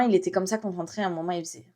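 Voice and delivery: normal talking voice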